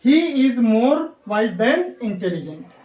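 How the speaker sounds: very slow and spaced out; slow model sentence. Shown as a demonstration. Teacher repeats the example slowly for students to follow. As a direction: spell the example out slowly for the class. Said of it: "बिल्कुल सही, then".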